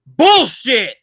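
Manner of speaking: loud, high crowd shout; a fan shouting from the crowd; heckler's yell. Retold or imitated. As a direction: bark it from the stands. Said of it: "somebody just goes".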